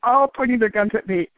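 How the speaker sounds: usual speaking voice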